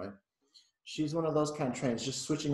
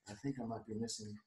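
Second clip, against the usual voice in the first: usual speaking voice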